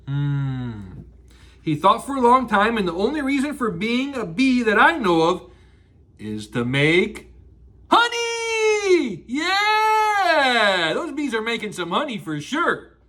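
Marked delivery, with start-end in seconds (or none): 0.1-1.0 low, soft thoughtful hmm; thinking hum. Acted. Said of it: "he thinks like this". 7.9-10.9 excited shout. Real, not acted. Big reveal to kids.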